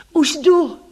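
high, loud answering cry. A narrator's reading. She answers the voice calling her, urgent high cry. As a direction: shout back urgently, pitched high. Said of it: "vykřikla jsem".

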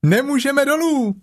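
very high little-kangaroo cry; high-pitched childlike exclamation; Roo calling out. Acted. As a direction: call down like a small child. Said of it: "zvolal klokánek".

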